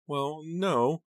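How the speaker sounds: very high, wavering-on-tears reply; aunt falling apart. A narrator's reading. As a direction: answer high and helpless, close to breaking. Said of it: "started to fall apart again".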